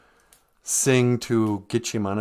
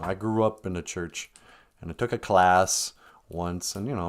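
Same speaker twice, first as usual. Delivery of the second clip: ordinary talk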